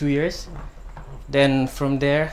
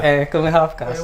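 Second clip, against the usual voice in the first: louder, laughing through it; 3 semitones higher, 6 dB louder than usual. Real, not acted. Guest joking back at the host, laughing loudly. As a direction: toss it out with a laugh.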